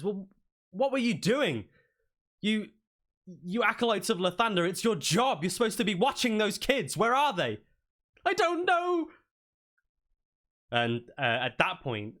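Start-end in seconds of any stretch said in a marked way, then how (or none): 0.0-7.6 high, loud scolding; accusing dwarf. Acted. Griselda scolds the acolytes, high and loud. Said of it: "pipes up and says". 8.2-9.1 very high, loud helpless wail; panicked reply. Acted. Priest answers Griselda, very high and panicked.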